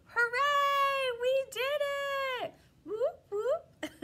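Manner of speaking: high, loud celebration cheer. Acted. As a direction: whoop it up with the kids.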